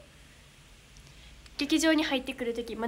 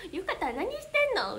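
imitated tsukkomi retort; 5 semitones higher than usual. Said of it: "突っ込んでくれます".

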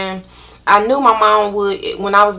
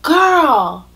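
slow, higher mock-disgusted friend; higher, slow dismayed voice; friend's disgusted retort; 8 semitones higher, 5 dB louder, much slower than usual. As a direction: draw out her disbelief, slow. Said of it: "She's like".

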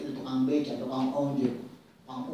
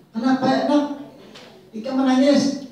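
concerned mother; 8 semitones higher, 8 dB louder than usual. Retold or imitated. Mother asks crying child.

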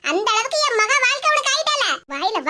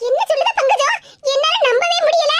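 higher and louder, struck by the news; stunned disbelief; 5 semitones higher, 4 dB louder than usual. Acted. Mother hearing her son betrayed her daughter, shocked and louder. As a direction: cry out that you can't believe it.

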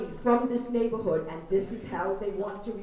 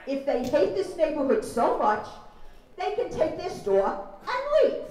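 rising outrage; 4 semitones higher, 8 dB louder than usual. Real, not acted. Heated close to public testimony.

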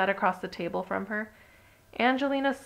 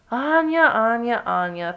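loud, exasperated repetition of a name, stepping down in pitch; miserable; 3 semitones higher, 7 dB louder, slower than usual. A narrator's reading. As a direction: miserable lament starting high and loud. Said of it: "thought Angelina miserably".

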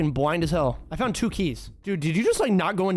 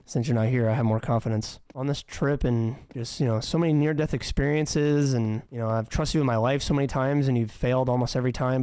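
mock earnest confession; 7 semitones lower than usual. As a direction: play it as a shy sincere proposal. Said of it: "oh, I'm too nervous".

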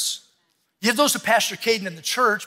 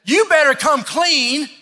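slow, emphatic nudge, pitched up; urging, raised voice; 7 semitones higher, 5 dB louder, slower than usual. Retold or imitated. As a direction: press them to come clean. Spouse nudging you to confess.

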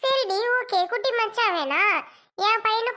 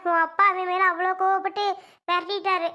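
usual speaking voice

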